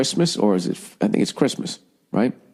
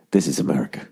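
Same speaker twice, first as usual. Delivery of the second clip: whispered low and secret, lower pitch; mock whisper; 8 semitones lower, faster than usual. Mock interrogator whispers to a Guantanamo prisoner. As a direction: hush it like a dramatic reveal.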